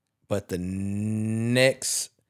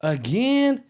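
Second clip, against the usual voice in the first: much higher, disbelieving; incredulous; 13 semitones higher, slower than usual. Real, not acted. Hosts hearing the WrestleMania news, incredulous. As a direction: question it in disbelief. Reacting to WrestleMania Vegas again.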